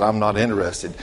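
ordinary talk, nothing marked

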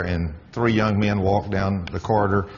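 ordinary talk, nothing marked

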